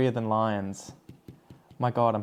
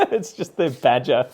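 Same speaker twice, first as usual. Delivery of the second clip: laughing through the words; 7 semitones higher, 4 dB louder than usual. Real, not acted. Vlogger telling how the badger broke in, laughing. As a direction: tell it through laughter, a little higher. Amused by own badger story.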